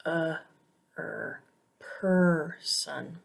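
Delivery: uh, er sounded alone, then person with long PER; isolated vowel sounds. Shown as a demonstration. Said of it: "this sound is always stressed".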